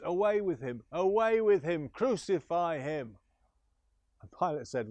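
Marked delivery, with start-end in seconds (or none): 0.0-3.2 mob crying out. Acted. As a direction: scream for his death like a mob. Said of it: "And they cried out".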